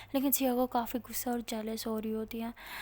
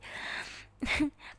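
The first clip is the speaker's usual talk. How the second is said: laughing; 3 semitones higher than usual. Acted.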